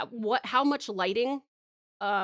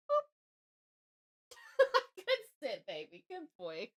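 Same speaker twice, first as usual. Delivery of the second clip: high, soft cooing at a dog; talking to her dog in a high soft coo; 8 semitones higher, 4 dB quieter than usual. Real, not acted. Baby talk to puppy.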